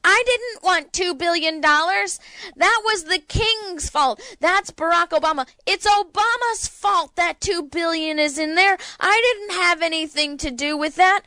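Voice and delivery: high, whiny mock politician voice; high-pitched mock protest of innocence; mocking Mitch McConnell. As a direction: play him as a sniveling weasel denying everything.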